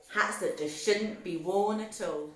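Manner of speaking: ordinary delivery, nothing marked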